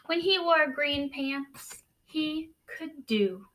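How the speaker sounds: slow, hushed storybook reading; read-aloud narration. A narrator's reading. Teacher reading a picture book to the class, hushed. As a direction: draw young listeners into the story.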